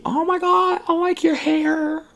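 high, soft mock-girl voice; imitating gushing girls. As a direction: gush in a high, drawn-out fangirl voice.

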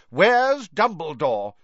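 loud, slow, high demand. A narrator's reading. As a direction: demand an answer like you outrank everyone. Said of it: "Fudge demanded of Missus Weasley".

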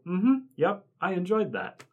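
high, bland approving voice; a content, empty nod of approval; a fast-food diner's vacant satisfaction; mock satisfied customer. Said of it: "everybody can go".

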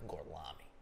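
low, soft mock Italian; Brad Pitt. Asked to repeat his fake name, low mock Italian accent. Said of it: "he's acting like he's Italian".

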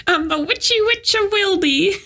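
witch voice. Pretend. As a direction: introduce yourself as a witch. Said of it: "very bad witch voice".

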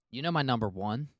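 normal talking voice

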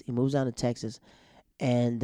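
normal talking voice

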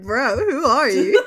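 loud, drawn-out disbelief through laughter; incredulous. Retold or imitated. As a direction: demand it, baffled and half laughing. Prank-like debt call, acted disbelief.

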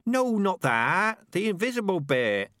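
high, loud, drawn-out correction; exasperated. Acted. Rat correcting a dim listener about the invisible part, exasperated. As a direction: stretch it out, losing patience.

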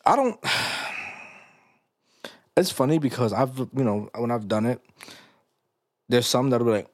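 usual speaking voice

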